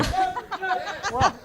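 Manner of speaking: high laugh. Real, not acted. Auctioneer laughs mid-bid.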